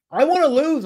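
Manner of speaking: loud, high-pitched protest; mock pleading. Contestant begs chat to let him lose, mock-desperate. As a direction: plead it loudly, as if outraged.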